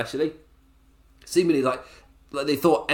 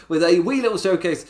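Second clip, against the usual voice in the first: put-on higher, louder country accent; 6 semitones higher, 5 dB louder than usual. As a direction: keep the country accent going through a laugh. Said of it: "Do I have to stop that accent?".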